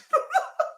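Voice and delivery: high, helpless laughter; real high laughing fit. Real, not acted. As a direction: crack up at your own impression. Cracks up at his impression.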